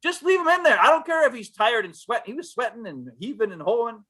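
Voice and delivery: normal talking voice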